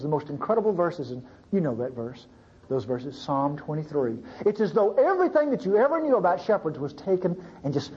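ordinary delivery

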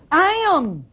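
lightly voiced Jesus, lifted high and ringing; calling out over the waves to calm them. Preacher voicing Jesus again.

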